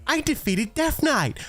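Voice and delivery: high-pitched, hammed-up mock advert voice; Lords Mobile advert. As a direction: gush like a fake game ad player. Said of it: "I'll do an impression of a Lords Mobile, um, advert".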